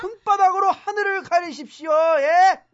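high, loud indignant jeer; exasperated, sarcastic shouting; scornful outburst. Acted. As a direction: blast their flimsy excuse at full voice.

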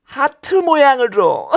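usual speaking voice

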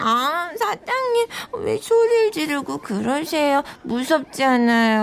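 tipsy, cutesy pleading; playful mock-frightened pout; flirty whining. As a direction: whine coquettishly to soften someone up. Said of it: "왜 이렇게 끼를 부려".

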